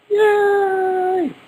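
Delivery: very high squeaky yay; a sudden high-pitched cheer; hammed-up squeal; mock cheer. Cheering two Louise videos.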